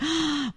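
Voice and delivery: high, soft mock gasp; mock gasp of longing. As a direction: gasp like a woman suddenly desperate. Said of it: "you're like".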